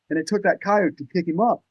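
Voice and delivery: usual speaking voice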